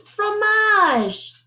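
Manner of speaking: very high, drawn-out poodle answer; high, eager poodle voice. A narrator's reading. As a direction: sing it out like a proud poodle.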